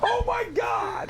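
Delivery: high, slow drawn-out shout; shocked shout. Real, not acted. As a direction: let it out in disbelief. Shocked at the dancing.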